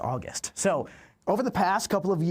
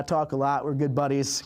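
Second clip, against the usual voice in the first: usual speaking voice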